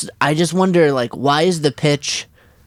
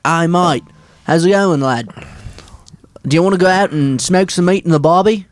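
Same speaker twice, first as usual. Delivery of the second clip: broad Australian vowels; Australian accent. Shown as a demonstration. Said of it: "never heard the Austra".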